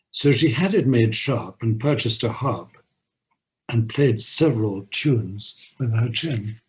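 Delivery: lively poem recitation with a bouncy beat; limerick reading. Acted. Host reading the Norway limerick aloud, lively recitation. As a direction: deliver the nonsense rhyme with relish.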